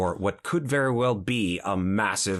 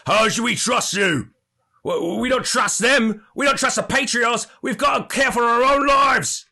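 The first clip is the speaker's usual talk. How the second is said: much higher, louder defiant shout; gruff man in the crowd; 14 semitones higher, 7 dB louder than usual. Acted. A man in the crowd shouting his distrust back, gruff and defiant. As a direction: yell your distrust back. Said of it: "A gruff voice peals out and says".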